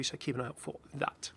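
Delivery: ordinary talk, nothing marked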